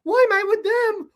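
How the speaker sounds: arrested lookalike whining to police, mock crying. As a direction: whimper it like the crying bystander. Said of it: "he's crying".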